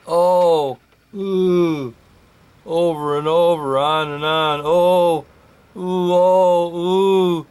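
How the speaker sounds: high, wavering mocking moans. A narrator's reading. Night monster mocking the trapped narrator's moan. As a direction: moan back like a taunting night creature. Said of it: "started mocking my lament".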